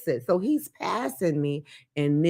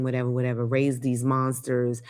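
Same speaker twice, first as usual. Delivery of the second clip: ordinary talk, nothing marked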